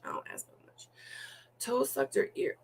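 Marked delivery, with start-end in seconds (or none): 0.1-0.9 soft whispered aside. Real, not acted.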